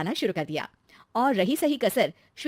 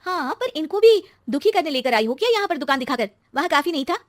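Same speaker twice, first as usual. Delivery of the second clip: put-on high, loud, resentful young wife; aggrieved complaint; 7 semitones higher, 7 dB louder than usual. Wife complaining to mother-in-law at the shop, resentful. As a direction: throw the question back bitterly.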